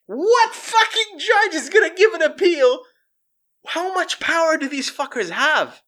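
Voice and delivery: high, strained incredulous rant; outraged disbelief. Real, not acted. Comic reacting to a drug lord's appeal, outraged. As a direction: demand an answer in high-pitched outrage.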